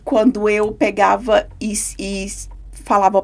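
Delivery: ordinary delivery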